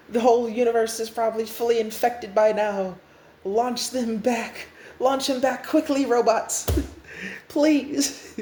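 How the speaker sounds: high, pleading urgency; panicked refusal. Acted. New Restart refuses to explain, panicked. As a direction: beg the robots in hammed-up alarm. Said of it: "He's like".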